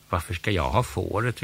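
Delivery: usual speaking voice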